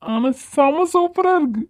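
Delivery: high, drawn-out relish; delighted. Acted. Player finding the game great fun, high delight. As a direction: stretch it out in a high, gleeful voice.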